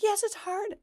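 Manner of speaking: high-pitched mock-kid complaint; aggrieved kid. Kid answering parent's sympathy, high mock complaint.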